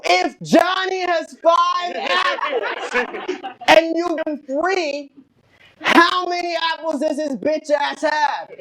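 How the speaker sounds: high, drawn-out yelling of a homework question; imitating screaming dad. Dad yelling math homework at his kid, screaming. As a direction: bellow it like an exasperated father. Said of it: "He was literally screaming".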